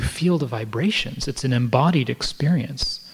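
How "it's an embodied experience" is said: normal talking voice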